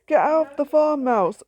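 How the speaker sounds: high, loud shout at a mouse. A narrator's reading. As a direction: yell to chase the mouse out. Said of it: "shouted Jack".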